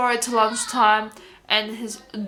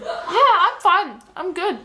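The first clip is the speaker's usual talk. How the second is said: high, drawn-out "I'm fine" act; retold put-on cheerfulness; 8 semitones higher, 3 dB louder, slower than usual. To a friend asking are you okay, falsely upbeat. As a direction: overdo being okay, pitch swooping up high.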